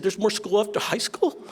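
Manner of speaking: put-on high, incredulous kid voice; childlike disbelief. Brothers hearing there is school after high school, high and incredulous. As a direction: ask it like a kid who cannot believe it. Kids shocked by more school.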